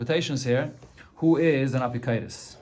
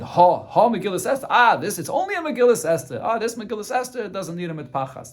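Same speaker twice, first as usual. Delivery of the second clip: much higher, scornful "it's only a Megillas Esther"; mocking dismissal; 7 semitones higher, 4 dB louder than usual. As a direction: belittle the scroll with a sneer. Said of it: "speaking not with the right kavod".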